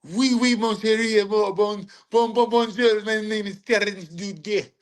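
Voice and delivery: high, hammed-up French caricature; mock French accent. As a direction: babble like a cartoon Frenchman. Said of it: "it's a French".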